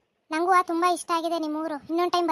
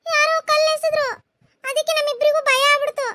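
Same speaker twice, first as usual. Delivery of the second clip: higher, louder anxious telling; scared report; 4 semitones higher, 3 dB louder than usual. Acted. Girls telling parents someone threw a stone, higher and anxious. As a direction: blurt out the scare.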